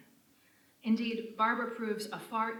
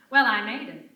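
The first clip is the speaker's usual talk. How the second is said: loud, put-on posh accent, much louder; phony upper crust accent; 3 semitones higher, 15 dB louder than usual. Lola arriving at the mansion in a loud fake posh accent. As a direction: grandly announce your arrival in fake high-society tones. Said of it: "in a phony upper crust accent".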